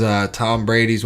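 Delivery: usual speaking voice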